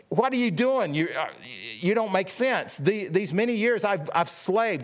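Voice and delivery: indignant complaint. Retold or imitated. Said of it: "But he answered his father".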